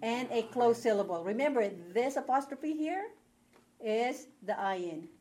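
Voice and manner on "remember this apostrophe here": ordinary delivery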